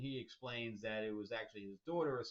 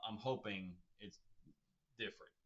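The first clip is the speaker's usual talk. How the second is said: normal talking voice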